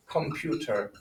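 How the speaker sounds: "computer" with a y-glide before the U, com-PYOO-ter; English U sound. Shown as a demonstration. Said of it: "they adopted that U sound".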